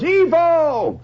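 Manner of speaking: high yelled call across a party; holler. Retold or imitated. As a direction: holler it to get the crowd's attention. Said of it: "somebody hollers".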